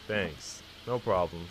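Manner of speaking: normal talking voice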